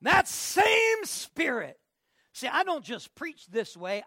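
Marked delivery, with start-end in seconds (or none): 0.0-1.8 high, slow, loud preacher's cry; preaching. Acted. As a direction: stretch it out like a revival call. Preaching climax.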